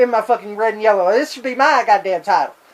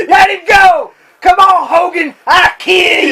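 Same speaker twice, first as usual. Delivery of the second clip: hammed-up Sid threat; mock shout; 7 semitones higher, 4 dB louder than usual. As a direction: yell a threat in a put-on voice.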